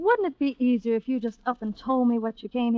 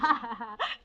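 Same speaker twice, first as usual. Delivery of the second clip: scornful laugh; 8 dB quieter than usual. Acted. Scornful laugh at his plea.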